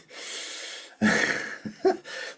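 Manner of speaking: breathy, wordless chuckle; real laugh after admitting annoyance; rueful laugh. Real, not acted. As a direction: laugh off your own annoyance.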